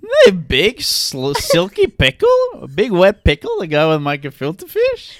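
high, incredulous customer complaint; hammed-up indignant diner; mock outrage. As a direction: draw out each missing item in disbelief. Playing outraged restaurant customer.

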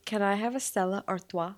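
Stella Artois said ar-TWAH, mock French; jokingly Frenchified Artois; playful fake-French Stella Artois; mock French order. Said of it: "she was joking around".

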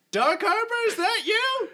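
high, loud startled shout; surprised hero. Acted. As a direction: call out in shocked recognition. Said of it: "he'll say".

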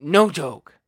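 building up to losing it; heated outburst. Real, not acted. Threatening meltdown over thermostat setting.

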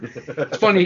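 ordinary talk

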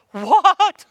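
very high, loud shocked yelp; shocked shout. Retold or imitated. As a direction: blurt it out in total disbelief. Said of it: "And I went".

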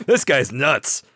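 ordinary talk, nothing marked

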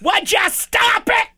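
high-pitched frantic shout. Retold or imitated. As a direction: yell it up high and slow. Acting out a sitcom scene.

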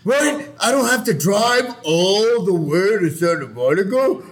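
high put-on voice, outraged complaint; mock pissed-off whine. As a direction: whine in disbelief at having to drive. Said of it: "we were pissed".

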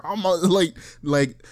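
ordinary talk

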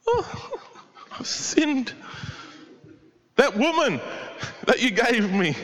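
high, laughing whiny excuse; sheepish Adam blaming. Retold or imitated. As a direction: act out Adam passing the blame with a nervous laugh. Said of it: "Blaming others".

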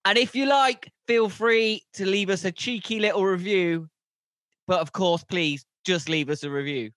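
big, shouted show intro; loud podcast intro. Acted. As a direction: belt out the podcast intro like a host.

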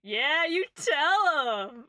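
high, loud, drawn-out cheer; drunk bard cheering. Acted. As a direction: egg on your orc wife, slowly and loudly. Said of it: "the lanky human pats, uh, the orc".

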